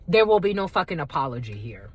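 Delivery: ordinary talk, nothing marked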